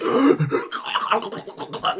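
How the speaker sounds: high gasp into gurgling choke; horrible choking noise. Retold or imitated. Game master imitating a dying victim, gasping gurgle. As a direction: gasp and gargle like someone dying. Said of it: "you hear this horrible, like, noise".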